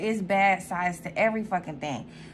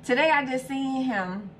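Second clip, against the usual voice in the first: normal talking voice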